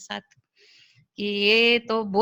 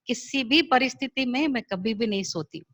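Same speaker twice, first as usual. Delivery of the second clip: ordinary delivery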